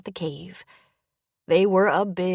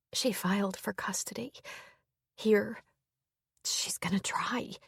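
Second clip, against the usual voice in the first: unvoiced whisper, much softer; 4 semitones higher, 5 dB quieter than usual. A narrator's reading. Hannah telling Owen about the custody case, whisper. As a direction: keep it down to a frightened breath. Continues whispered bad news.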